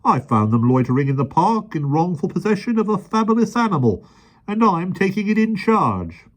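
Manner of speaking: high, loud blustering officer; pompous policeman. A narrator's reading. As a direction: lay down the law pompously.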